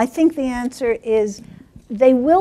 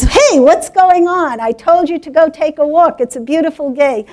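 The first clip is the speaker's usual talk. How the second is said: higher, louder exasperated mother, easing off; scolding mother cat; 4 semitones higher, 5 dB louder than usual. Acted. Mother cat to returning kittens, scolding. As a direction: demand an explanation, starting high and loud. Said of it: "And the mother cat says".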